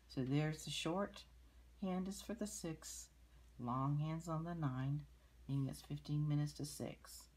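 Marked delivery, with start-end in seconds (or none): none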